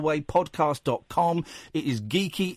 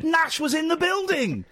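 high, drawn-out cry of disbelief; incredulous exclamation; 11 semitones higher than usual. Real, not acted. Host telling of the near-miss at Atlantic Records, incredulous exclamation. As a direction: pitch it way up in amazement. Exclaims over amazing coincidence.

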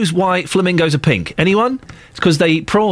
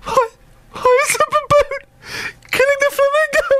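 imitating a sobbing little boy, very high squeak; mock tearful wail in a tiny high voice; upset child. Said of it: "the boy's starting to get upset".

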